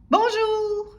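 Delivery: high, loud drawn-out yell; bellowing grocer. A narrator's reading. Grocer greeting timid customer, yelling. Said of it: "hurlait l'épicier".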